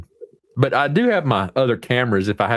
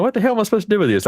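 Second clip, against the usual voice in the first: incredulous; 8 semitones higher, much faster than usual. Real, not acted. Confused by Vegemite.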